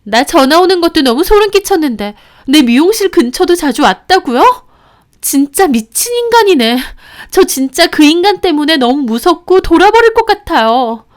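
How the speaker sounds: high, loud, bitter venting through clenched teeth; furious ranting. Retold or imitated. Ex-wife raging to the narrator about her stalker, loud and high. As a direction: spit out the words, outraged and scared. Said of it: "이를 바득바득 가는데".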